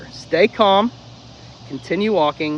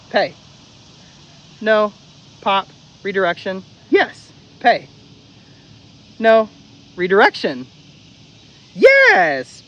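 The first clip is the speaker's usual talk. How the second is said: higher, louder training cues in rhythm; rhythmic drill; 5 semitones higher, 6 dB louder, slower than usual. Shown as a demonstration. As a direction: call out each cue crisply. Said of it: "So again".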